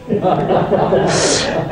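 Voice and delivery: a short real laugh at his own punchline. Real, not acted. Lecturer laughing at his own courtroom joke, real laugh. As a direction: laugh at the joke you just told.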